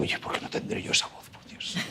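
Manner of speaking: whispered. Retold or imitated. Envying men's voices, aside.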